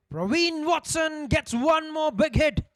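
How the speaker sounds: slow, high excited commentator shout. Real, not acted. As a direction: call the big hit with a roar. Excited call of a six.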